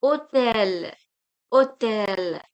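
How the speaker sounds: hotel as "oh-tel", silent H, sounded L; French hotel. Shown as a demonstration. Teacher showing final L, hotel as "oh-tel". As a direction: say hotel loudly, no H, clear final L. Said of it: "for L".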